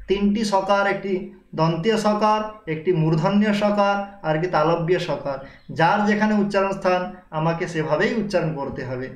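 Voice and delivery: ordinary talk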